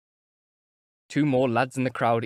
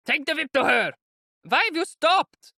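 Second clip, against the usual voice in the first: very high, loud taunting cries; jeering shouts; 4 dB louder than usual. A narrator's reading. The crowd jeers at Mikolka, loud taunting shout. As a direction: egg him on from the crowd. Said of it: "voices shouted from the crowd".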